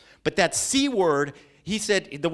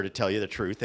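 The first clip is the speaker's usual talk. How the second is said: normal talking voice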